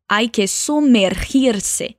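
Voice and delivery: sumergirse said without any B sound, slowly; spanish pronunciation. Shown as a demonstration. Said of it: "atenção que a gente tira o B".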